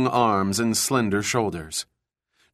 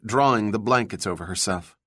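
ordinary delivery, nothing marked

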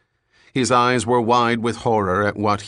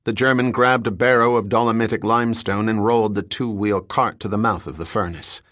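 ordinary delivery

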